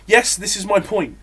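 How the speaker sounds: put-on exasperation at a journalist; mock exasperated rant. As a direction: play an irritated politician swatting a journalist. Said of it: "he'll just be like".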